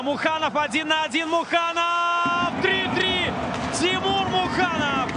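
rising shouted goal call; excited hockey goal call. Real, not acted. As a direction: yell out the equaliser. Commentator calls tying goal.

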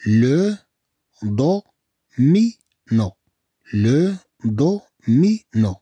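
slow, separated "لو، دو، مي، نو"; teacher breaking the word apart slowly; syllable by syllable. Shown as a demonstration. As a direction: sound out each syllable with a gap.

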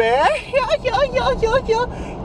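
squeaky high-pitched goofy voice; goofy falsetto talk to the viewers; silly high voice. Pretend. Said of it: "Why am I talking like this?".

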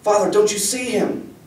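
high-pitched, incredulous appeal; distressed pleading. A narrator's reading. Girl asking father if he sees the god, distressed pleading.

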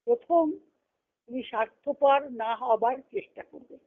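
ordinary talk, nothing marked

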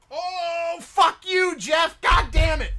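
yelling, high and loud; furious swearing outburst; angry shout. Real, not acted. Real outburst at stream alert.

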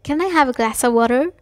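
American pronunciation. Shown as a demonstration. Teacher contrasts versions for learners, American accent. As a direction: ask it with an American "water".

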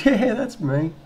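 high, loud, chirpy caller impression; policewoman on phone. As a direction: imitate a woman announcing herself on the phone. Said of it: "She goes".

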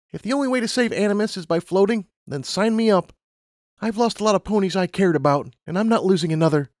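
high-pitched resolve; Lumina, determined. A narrator's reading. Lumina vowing not to lose another pony, determined.

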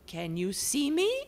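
high, curious portrait lady. Acted. Portrait asks the house if it can see her. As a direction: ask it eagerly.

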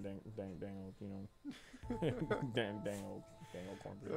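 soft, lightly done King of the Hill twang; King of the Hill accent. Pretend. Trailing off in a terrible King of the Hill accent. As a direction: mumble it like a bad Hank Hill. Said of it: "terrible King of the Hill accent".

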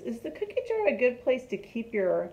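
ordinary delivery

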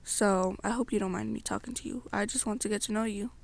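ordinary delivery

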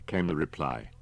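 plain reading voice

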